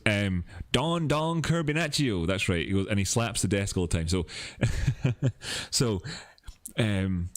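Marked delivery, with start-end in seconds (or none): 0.7-2.3 imitating Crypto Kirby. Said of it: "no, he goes".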